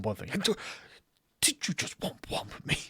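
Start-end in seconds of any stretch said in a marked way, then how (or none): 1.4-2.8 mock offended, hushed.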